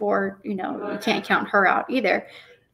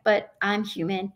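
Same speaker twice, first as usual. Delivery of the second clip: ordinary talk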